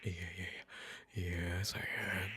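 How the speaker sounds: low, hushed murmur; soft comforting. Acted. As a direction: murmur gently to settle the child. Father murmurs comfort to child. (bergumam lembut menenangkan)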